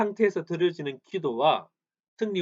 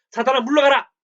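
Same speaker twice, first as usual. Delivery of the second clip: commanding rebuke; 8 semitones higher, 8 dB louder than usual. Acted. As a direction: drive the devil out with full force. Man rebukes Satan in prayer.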